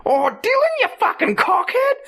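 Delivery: put-on high shrieking mum yelling at her kid; hammed-up shrill mother's outburst; mock furious shriek. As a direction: screech it like a fed-up mother. Mother screaming at son, retold.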